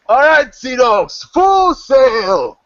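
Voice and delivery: high, loud first mate's shout; stretched-out deck holler; yelling back. Acted. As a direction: bellow the order to the sea dogs. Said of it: "And he yells back".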